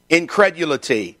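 ordinary talk, nothing marked

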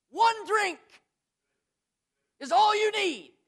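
high ringing cry on "One drink"; preaching shout. Real, not acted. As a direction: lift it up and ring it out. Preacher punches the point.

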